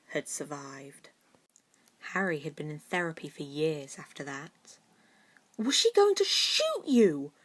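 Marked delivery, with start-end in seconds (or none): 5.6-7.3 high, loud frightened squeak; a scared girl's squeak, much higher and louder; terrified squeak. A narrator's reading. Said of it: "Pansy asked with a terrified squeak".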